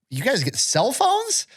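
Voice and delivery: high-pitched incredulous question; mock disbelief. Older generation reacting to recruits' phones, mock outrage. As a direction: act out scandalized astonishment, pitch way up.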